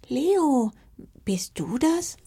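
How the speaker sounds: high, hesitant questioning; astonished. A narrator's reading. Little fox recognising Leo among the puppies, astonished. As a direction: ask in disbelief, pitch lifted. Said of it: "fragte sie erstaunt".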